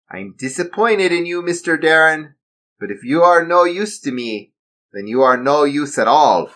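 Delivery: high, cold German villain; disappointed, menacing German accent. Acted. Villain condemning Darren, German accent. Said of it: "He spoke in a German accent".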